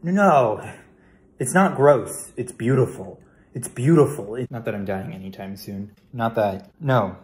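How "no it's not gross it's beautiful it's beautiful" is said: loud, raised-pitch defensive insistence; insistent protest. Acted. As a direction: push back hard to win the listener over. Defends freezing idea to wife.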